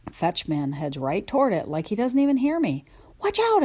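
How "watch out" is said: very high, loud yelp; barked warning. Acted. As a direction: yelp it out in alarm. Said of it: "I bark".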